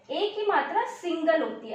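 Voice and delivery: ordinary talk, nothing marked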